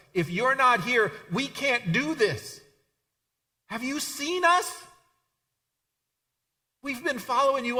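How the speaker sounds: high-pitched, insistent appeal; desperate pleading. Acted. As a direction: plead with a leader not to leave, voice climbing. Acted Peter pleading with Jesus.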